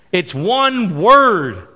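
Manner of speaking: slow, loud, deliberate statement; preacher building to the key point; slow emphatic. Acted. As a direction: lean hard into each word. Sermon build to the answer.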